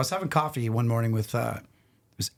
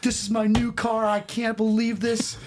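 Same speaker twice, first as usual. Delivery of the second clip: imitating an outraged friend, pitched way up; freaked-out rant; 11 semitones higher than usual. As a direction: whine in high-pitched, mock-frantic disbelief. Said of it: "I'm just letting him rant".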